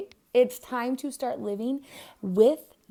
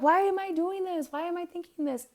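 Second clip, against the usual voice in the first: doubting self; 8 semitones higher than usual. Retold or imitated. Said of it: "you catch yourself like".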